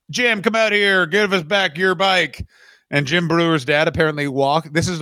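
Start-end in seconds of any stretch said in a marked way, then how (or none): 0.1-2.5 higher-pitched taunting demand from outside; mock calling out. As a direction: call him out of the house, stretching it. Gang calls out at the house.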